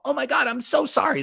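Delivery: high, whiny sorry; mock simpering apology. Host mocking a girl apologizing to online trolls. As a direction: squeak it out like a scared girl. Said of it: "She's all".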